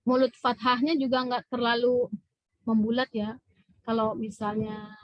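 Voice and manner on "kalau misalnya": usual speaking voice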